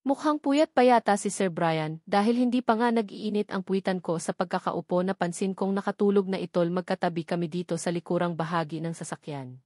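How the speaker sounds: ordinary delivery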